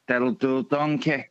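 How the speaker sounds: lightly voiced Shrek; a touch of the movie ogre; Shrek impression. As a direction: do a bit of Shrek. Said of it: "did you like Shrek".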